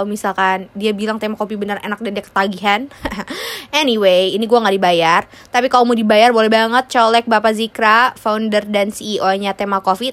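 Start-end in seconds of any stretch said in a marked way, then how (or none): none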